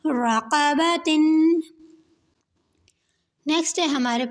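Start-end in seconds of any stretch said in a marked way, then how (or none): 0.1-1.2 slow blending. Shown as a demonstration. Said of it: "تو کیا بن جائے گا".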